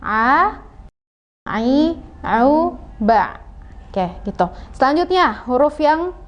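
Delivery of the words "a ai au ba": slow, not overdone ain; moderate ain. Shown as a demonstration. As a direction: say a, ai, au, ba from the mid throat without forcing. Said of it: "kita ulang".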